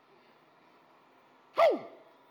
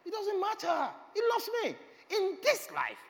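excited exclaim; 9 dB louder than usual. Real, not acted. Preacher rises to a climax.

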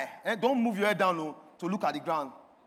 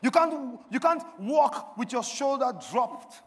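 forceful preaching; 5 semitones higher, 6 dB louder than usual. Real, not acted. Preacher encouraging the congregation to look up, forceful preaching. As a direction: hammer each promise home deliberately.

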